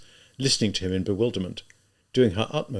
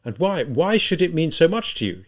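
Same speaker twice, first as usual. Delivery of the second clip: higher-pitched, exasperated question; anguished, insistent demand; despairing cry; 7 semitones higher, 4 dB louder than usual. A narrator's reading. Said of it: "he cried out a moment later".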